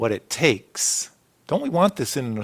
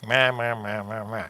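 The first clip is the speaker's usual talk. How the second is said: mocking drone; 5 semitones lower, 6 dB quieter than usual. Mocking the teachers of the law.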